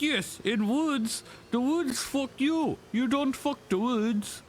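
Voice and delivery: high, slow, heavy mock Russian accent. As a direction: drag out the words in a thick fake Russian accent. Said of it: "I did do a good Russian accent".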